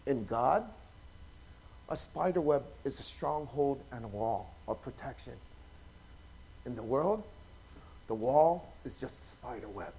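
solemn quote. Retold or imitated. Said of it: "He said".

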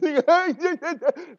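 loud, high imitation sobbing; mock bawling. Groom imitating his ten minutes of wedding tears, mock bawling. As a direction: burst into exaggerated wails. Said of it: "why did I cry".

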